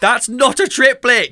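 loud, high, slow cry of disbelief; exasperated outburst. Real, not acted. Host shouting at a drummer's wrong triplet lesson, outraged and loud.

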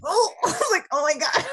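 high, loud startled oh my God; shocked gasp. Retold or imitated. Patient squealing during the doctor's procedure. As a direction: squeal with surprise.

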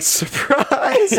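high, sing-out "surprise"; teasing high-pitched jump-out call; mock surprise greeting. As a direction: pop out with a high, drawn-out prank greeting. Acted prankster jump-out at friend.

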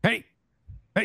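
short high 'hey' calls, spaced apart; dog that sounds like someone calling; imitated dog calling out. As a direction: call 'hey' like a talking dog. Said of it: "It sounded like someone going".

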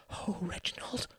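high, hushed, breath-filled cry; distressed woman's cry. A narrator's reading. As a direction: gasp it softly as an anguished mother. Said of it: "cried a woman's voice".

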